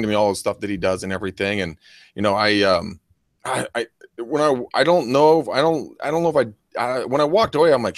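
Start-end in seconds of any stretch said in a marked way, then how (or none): none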